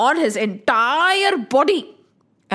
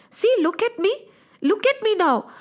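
higher, eager show-off; proud Hanuman; 8 semitones higher than usual. Acted. Hanuman shows Ram his red body, eager and proud.

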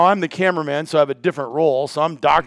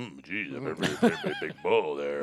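much lower, softer rueful mumble; mock sheepish Brad; 13 semitones lower, 13 dB quieter than usual. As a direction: grumble it like you regret passing. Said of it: "And he's like".

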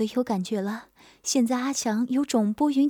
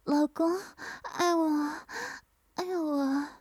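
higher, soft and pleading; wife softly begs her husband, high and soft; coquettish murmur; 4 semitones higher, 5 dB quieter, slower than usual. A narrator's reading. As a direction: coo softly to him. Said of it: "娇嗔道".

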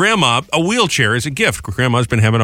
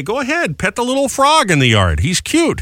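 coaxing parent; 9 semitones higher than usual. Retold or imitated.

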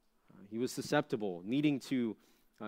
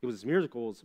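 ordinary delivery

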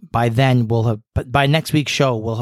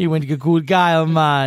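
put-on Jamaican accent; 5 semitones higher than usual. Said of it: "I went all Jamaican".

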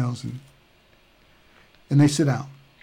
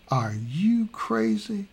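high, slow, incredulous cry; as if his master has lost it; freaking out; 8 semitones higher, slower than usual. Retold or imitated. Gehazi questioning Elisha's sanity, high slow disbelief. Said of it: "Gehazi really freaking out".

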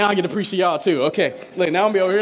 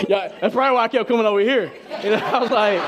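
laughing; 5 semitones higher than usual. Real, not acted. Laughing at own nerves.

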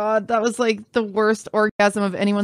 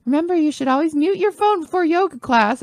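high, singsong mimicry of an instructor; yoga teacher reminder; 6 semitones higher than usual. Yoga teacher reminding an embarrassed student, mock high reminder. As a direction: imitate a sweetly chiding yoga teacher, pitched up. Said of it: "And she's like".

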